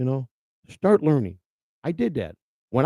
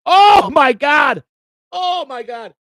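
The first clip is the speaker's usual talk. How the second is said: real shout, much higher and louder, dragged out slowly; overwhelmed joy bursting out; stunned shout; 10 semitones higher, 10 dB louder, much slower than usual. Real, not acted. Huge gift surprises streamer.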